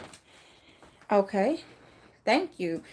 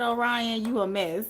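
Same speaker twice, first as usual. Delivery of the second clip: ordinary delivery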